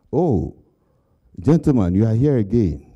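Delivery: mock surprise. Interviewers greeting a returning applicant, mock surprise. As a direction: greet him like a panel that recognises a repeat applicant.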